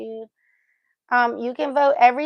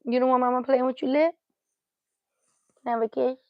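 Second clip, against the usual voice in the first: ordinary delivery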